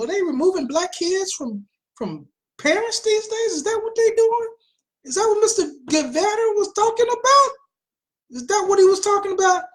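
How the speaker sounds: high-pitched feigned innocent questioning; rhetorical, teasing incredulity; mock disbelief. Mock questions about the quoted tweet.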